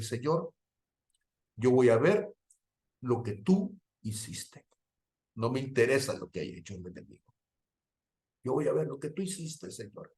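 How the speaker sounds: súplica contenida que se va apagando; llanto recordado. Retold or imitated. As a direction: dilo como oración entre lágrimas, cada vez más bajo. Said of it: "me solté llorando y dije". (oración llorosa apenas insinuada)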